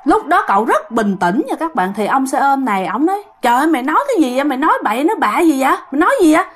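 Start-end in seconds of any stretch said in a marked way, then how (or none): 3.4-6.4 higher, fast disbelieving exclamation; shocked. Retold or imitated. Shocked motorbike driver questions the young man. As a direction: blurt it out in alarm.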